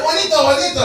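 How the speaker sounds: high, loud call across the yard. Retold or imitated. Owner calling his rooster, loud high call. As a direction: sing out the rooster's name.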